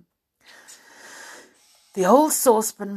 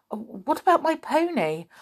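anxious; 6 semitones higher than usual. Acted. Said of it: "asked Joe anxiously".

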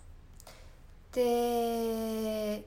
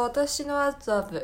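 slow, raised title announcement; drawn-out title call; 7 semitones higher, much slower than usual. Shown as a demonstration. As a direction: stretch the title out like a show opener. Title announced a second time.

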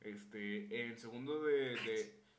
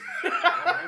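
loud shrill-free laugh bursting out, much higher; real laughing fit; laughing hard at himself; burst of high laughter; 15 dB louder than usual. Real, not acted. Laughing at own story.

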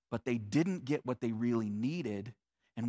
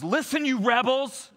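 louder, higher, yelling at the crowd; angry shout; 9 semitones higher, 6 dB louder than usual. Acted. Moses shouting at the complaining people, a furious yell. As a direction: bark it out at the rebels. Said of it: "He shouts, he's yelling at them".

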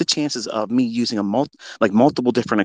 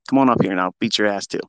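normal talking voice

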